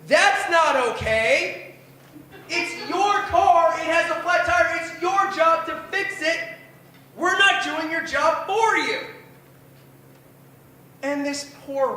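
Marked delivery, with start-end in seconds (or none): 0.1-9.0 father scolding. Retold or imitated. Father berating rental agent.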